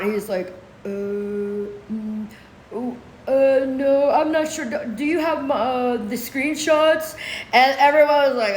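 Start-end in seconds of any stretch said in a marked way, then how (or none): 0.8-7.2 long dopey hums and hesitations; mock stumped man. Accuser asking the judge about screenshots, mock stumped. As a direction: imitate a clueless man stalling.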